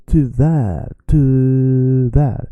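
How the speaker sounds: to that with the to drawn out; to that, first syllable held long; stretched first syllable. Shown as a demonstration. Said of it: "I stretch the first syllable".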